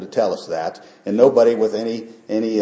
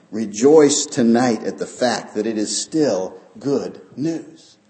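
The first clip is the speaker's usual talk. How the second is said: ordinary talk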